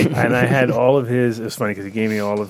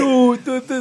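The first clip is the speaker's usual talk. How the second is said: mocking kids' taunt; 13 semitones higher, 4 dB louder, slower than usual. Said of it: "Hey, who's this kid".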